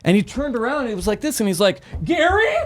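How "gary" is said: high, rising frantic calls for Gary; panicked high calling. Retold or imitated. Agent searching for his lost Bluetooth, panicked high. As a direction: squeak his name in shock. Shocked guy searching for headset.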